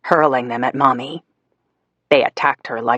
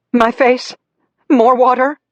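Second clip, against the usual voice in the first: high, louder pained mother's cry; Mommy in pain; 9 semitones higher, 5 dB louder than usual. Acted. Burned Mommy begging Daddy, high pained cry. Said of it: "Mommy wailed".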